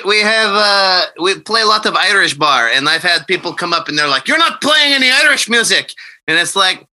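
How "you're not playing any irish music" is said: high-pitched accusing patron; mock complaint. Imitating people who come up at the Irish bar, mock complaining. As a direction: imitate a pub-goer objecting, pitched up.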